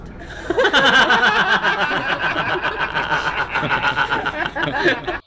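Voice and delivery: big, real burst of laughter; hearty real laughter after the joke; long loud laugh. Real, not acted. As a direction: laugh hard at the punchline. Laughs at own joke punchline.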